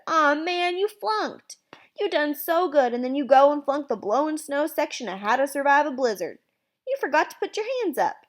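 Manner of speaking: drawn-up, exaggerated letdown; mock dismay. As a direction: scold him in a high, mock-sorry voice. Brother mocks after catching breath.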